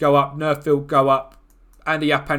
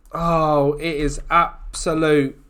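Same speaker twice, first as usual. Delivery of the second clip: normal talking voice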